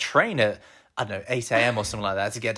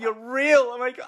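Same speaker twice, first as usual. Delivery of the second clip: much higher, squealing excited voice; imitating excited girlfriend; 12 semitones higher than usual. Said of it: "high voice when you're, like, really excited".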